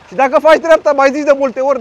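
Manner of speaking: arguing with a driver who cut the queue, shouting; furious shout. Real, not acted. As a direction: blow up at the driver. Heated argument in traffic queue.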